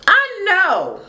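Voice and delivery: high "know" falling at the end; high-pitched exclamation. Real, not acted. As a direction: squeal "I know" in one go. Mock exclamation, then repeated stretched.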